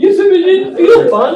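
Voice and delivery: laughing. Retold or imitated. Laughing mock quote.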